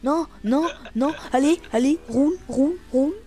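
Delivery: high urgent chant; excited urging. Real, not acted. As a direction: push the bike on, urgently. Urging bike in race.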